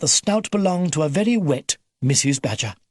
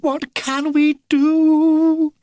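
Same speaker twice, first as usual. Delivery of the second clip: very high, slow, loud wail; 13 semitones higher, 5 dB louder, much slower than usual. A narrator's reading. Missus Badger despairing at the growlers, a slow high wail. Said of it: "wailed Missus Badger".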